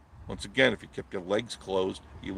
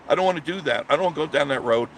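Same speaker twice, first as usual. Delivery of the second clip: normal talking voice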